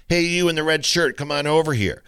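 calling out. Acted. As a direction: hail a stranger across the court, lightly acted. Acted example of impersonal calling out.

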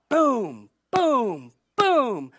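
three spaced booms, each falling in pitch; imitated pot banging. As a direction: mimic a spoon banging on a pot. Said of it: "maybe with a spoon on a pot".

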